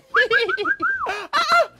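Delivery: long, high-pitched giggle; high cackling laugh. Acted. Man laughing at his turkey joke, high cackle.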